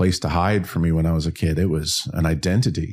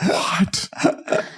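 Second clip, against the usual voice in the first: stunned breathy what; 11 semitones higher than usual. Retold or imitated. Stunned at being introduced to heroes.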